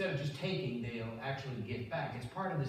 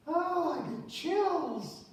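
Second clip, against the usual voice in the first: wife's breathy swoon, much higher and slow; hammed-up melting wife; 5 dB louder, much slower than usual. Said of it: "She goes".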